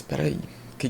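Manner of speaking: stunned whisper; whispered disbelief at the free meal offer. Retold or imitated. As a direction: breathe it out, barely voiced.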